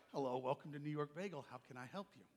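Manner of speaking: soft customer-service greeting. Retold or imitated. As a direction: drop to a quiet, polite welcome. Acts out greeting a customer, softly.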